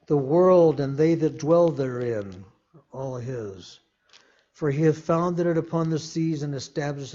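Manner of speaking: loud, ringing scripture proclamation; preaching at full force. Acted. Preacher reads Psalm twenty-four aloud to his flock, proclaiming. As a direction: declaim it to the whole church.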